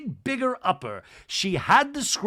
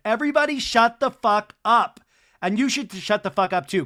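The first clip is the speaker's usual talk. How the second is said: mock yell; 5 semitones higher, 5 dB louder than usual. Angie yelling retold.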